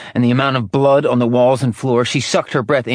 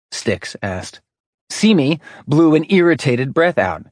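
ordinary delivery, nothing marked

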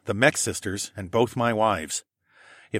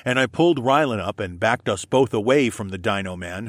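plain reading voice

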